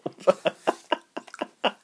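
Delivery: unvoiced wheezy laugh; breathy laugh. Real, not acted. Owner laughing at the cat's yawn, wheezy silent laugh.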